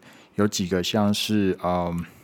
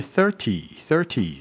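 thirty with thir higher; stress on thir; 5 semitones higher, slower than usual. Shown as a demonstration. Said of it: "前面那一个声音是比较高音的". (thirty前面的thir念高音)

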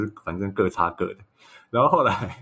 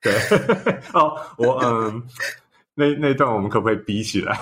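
ordinary delivery

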